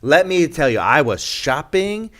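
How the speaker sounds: mimicking Betsy. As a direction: imitate her diving in without a hello. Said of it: "She picks it up and goes".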